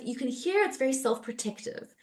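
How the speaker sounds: ordinary delivery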